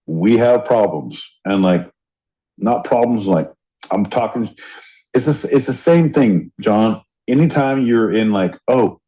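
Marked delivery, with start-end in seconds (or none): none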